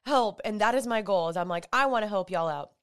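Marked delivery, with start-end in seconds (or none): none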